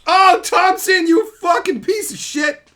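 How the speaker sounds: rip into the viewer; hammed-up fake fury; mock roast. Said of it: "You landed on insult".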